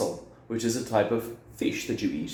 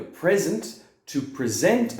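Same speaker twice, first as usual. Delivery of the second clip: first "present" as PREZ-ent, second "present" as pre-ZENT; shifting word stress; 6 semitones higher, slower than usual. Shown as a demonstration. Teacher showing one spelling's sounds, "present" as PREZ-ent then pre-ZENT. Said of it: "this one has three different sounds".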